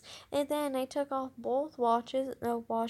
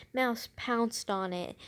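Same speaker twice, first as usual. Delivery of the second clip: ordinary delivery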